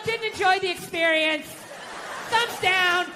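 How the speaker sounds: high-pitched complaining reviewer; wife as disappointed customer; mock bad reviewer. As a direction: deliver a prim thumbs-down review. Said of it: "bad reviews on the fucking husband".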